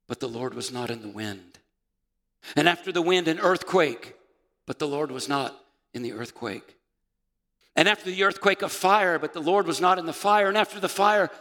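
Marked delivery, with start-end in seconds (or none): 2.5-4.1 loud, higher earthquake narration; dramatic reading. A narrator's reading. Preacher reading Elijah's story to the church, loud and dramatic. 7.8-11.3 loud, raised scripture narration; dramatic reading. A narrator's reading. Preacher building Elijah's story to the fire, loud and dramatic.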